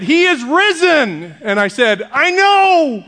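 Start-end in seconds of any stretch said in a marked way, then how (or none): 0.0-1.3 high, drawn-out loud greeting. Retold or imitated. Man greeting the pastor at Easter service, loud voice. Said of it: "in a loud voice said". 2.1-3.0 high, loud mock-oblivious yell; shouted reply. Pastor answering the Easter greeter, loud shouted reply.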